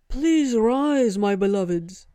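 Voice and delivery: loud, high-pitched monkey devotee; high, booming proclamation from a devoted ape; booming gibbonish voice. A narrator's reading. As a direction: boom it out like a grand ape herald. Monkey god implores them to rise.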